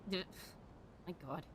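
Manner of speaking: ordinary talk, nothing marked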